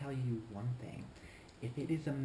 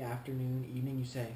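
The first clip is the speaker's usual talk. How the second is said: normal talking voice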